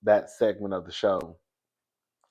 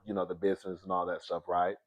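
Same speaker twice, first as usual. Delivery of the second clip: normal talking voice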